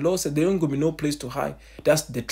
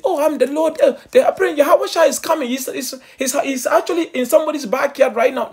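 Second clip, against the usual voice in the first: high, loud, laughing parody of a deceiver; mocking false prophet; 13 semitones higher, 7 dB louder than usual. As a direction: send up a false messiah with a laugh. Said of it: "trying to say".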